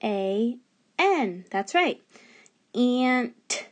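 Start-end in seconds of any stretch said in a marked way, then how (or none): 2.7-3.7 "Ant" as "an", a short break, then a lone "t"; segmented word. Shown as a demonstration. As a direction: sound out "Ant" with the final t set apart. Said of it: "hear a power word at the beginning".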